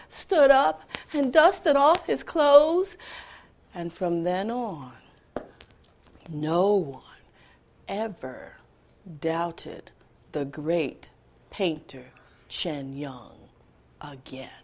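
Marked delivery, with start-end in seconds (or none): 3.7-14.5 much softer, lower, fading to a whisper; hushed ending. A narrator's reading. Storyteller closing the tale, hushed. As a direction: let the story fade away.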